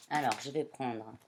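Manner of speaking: usual speaking voice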